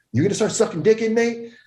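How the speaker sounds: high-pitched challenging question; mock incredulous demand; retold interrogation. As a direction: press the question, pitched well up. Retold lieutenant interrogating him.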